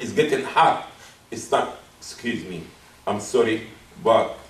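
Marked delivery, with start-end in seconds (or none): 2.0-4.4 soft, low, polite apology; polite excuse. Acted. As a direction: excuse yourself quietly, without disrespect. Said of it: "does not have to be disrespectful".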